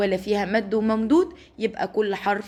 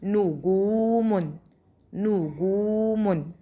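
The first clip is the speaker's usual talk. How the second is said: drawn out very slowly, syllable by syllable; slow syllable demo; much slower than usual. Shown as a demonstration. Teacher sounding out a word slowly for first graders. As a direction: stretch each syllable for the children. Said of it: "نو".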